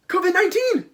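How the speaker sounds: mock high exclamation. Joke plague aside, high.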